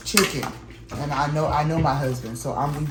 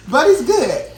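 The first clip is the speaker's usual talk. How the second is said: excited; 10 semitones higher, 8 dB louder than usual. Real, not acted. Loud after laugh.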